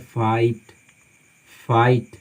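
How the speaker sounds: फाइट with GH silent; correct pronunciation. Shown as a demonstration. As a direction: say फाइट as "fait", vowel straight into T. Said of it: "GH silent है".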